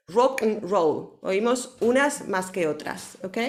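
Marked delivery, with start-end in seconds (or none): 0.1-1.2 Rock and roll slowed, and swallowed between two stressed beats; slow it down, punch rock and roll, swallow and; teacher shows English rock-and-roll rhythm; stress-timed rhythm. Shown as a demonstration. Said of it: "y el and nos lo comemos".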